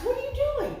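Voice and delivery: very high-pitched mock questioning; acted mother, voice leaping up high; exasperated questioning. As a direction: pitch the question way up, imitating a parent. Mary questions Jesus in front of elders.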